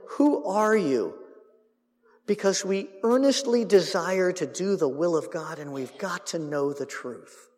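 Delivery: slow, sincere-sounding questioning; mock earnest. Shown as a demonstration. Priests asking John, in mock earnestness. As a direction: ask as if truly seeking truth. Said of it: "read verse twenty-two a little creatively".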